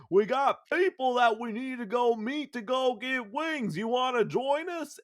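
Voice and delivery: a mock friendly invite; eager buddy invite. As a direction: pitch it up like a pushy friend. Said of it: "Deacon Saint John's like".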